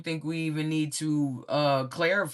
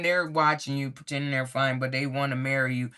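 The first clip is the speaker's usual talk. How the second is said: ordinary delivery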